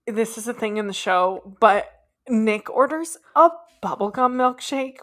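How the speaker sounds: usual speaking voice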